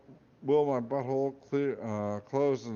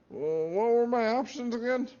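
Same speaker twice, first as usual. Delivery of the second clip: much higher, louder nervous question; flustered high voice; 12 semitones higher, 4 dB louder than usual. Acted. Boy asking the hat for options, squeaky and flustered. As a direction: ask it in a squeaky panic.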